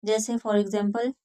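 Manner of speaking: ordinary delivery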